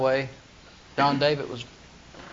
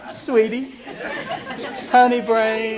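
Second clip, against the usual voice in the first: louder, laughing pet names; hammed-up Delilah calling out endearments; mock sweet Delilah; 6 semitones higher, 3 dB louder, slower than usual. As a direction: tease him with syrupy pet names. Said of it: "Delilah said to Samson".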